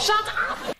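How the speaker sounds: high shocked shut up; exasperated shout. Real, not acted. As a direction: shriek it in disbelief. Exclaiming at a reader's comment.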